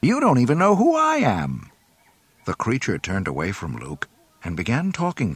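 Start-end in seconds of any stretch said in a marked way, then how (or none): 0.0-1.7 high, loud, indignant protest; a little angry. A narrator's reading. Luke protests to the strange creature, angrily. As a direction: protest with rising temper. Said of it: "asked a little angrily".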